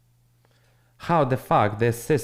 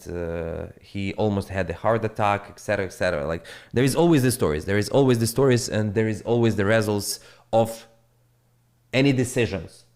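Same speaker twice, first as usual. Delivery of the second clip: ordinary delivery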